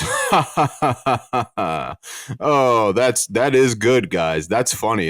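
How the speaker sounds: a high, mocking laugh then a slow smug drawl; snide laugh. Jesus mocking his disciples at communion, snide and smug. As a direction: sneer at the disciples with fake laughter. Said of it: "very snidely goes".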